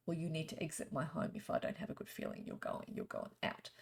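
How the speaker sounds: firm whispered command; whispering. Pretend. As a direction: whisper them out the door. Telling a spirit to leave.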